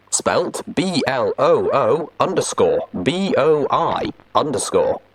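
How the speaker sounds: usual speaking voice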